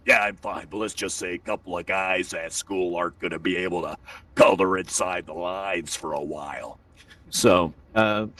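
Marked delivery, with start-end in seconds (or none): 0.1-6.8 tough-guy son. Retold or imitated. Son tells dad about tetherball fight, tough swagger. Voices his very strong son.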